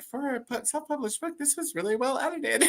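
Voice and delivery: backhanded compliment. Retold or imitated. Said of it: "I have actually left on a review".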